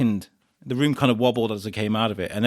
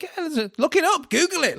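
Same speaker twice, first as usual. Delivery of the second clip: much higher imitated comedian voice; Ross Noble. Comedian telling doubting audience to check, high imitated voice. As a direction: urge the crowd in a high voice. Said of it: "And he's like".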